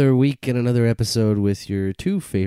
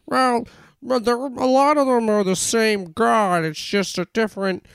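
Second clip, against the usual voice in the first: high-pitched mocking arguer's voice; imagined objector; 10 semitones higher than usual. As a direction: put on a high voice to mimic a defensive believer. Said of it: "I can hear the arguments".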